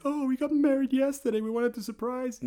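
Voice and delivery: high, bright wife voice; high mock sweet voice. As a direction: lilt it up like a giddy bride. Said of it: "she's like".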